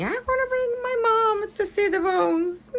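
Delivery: very high, put-on child voice; little kid voice. Mother voicing the new kid in the drawing, little kid. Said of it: "That's the new kid saying".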